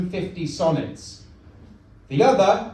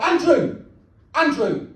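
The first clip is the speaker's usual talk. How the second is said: higher mock call, first one stronger; imitated girlfriend shouting; 6 semitones higher, 3 dB louder than usual. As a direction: call out sharply as a cross girlfriend. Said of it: "my girlfriend shouts out".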